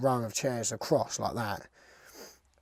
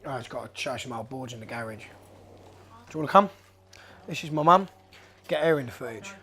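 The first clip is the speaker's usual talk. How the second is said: usual speaking voice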